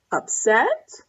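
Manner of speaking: ordinary delivery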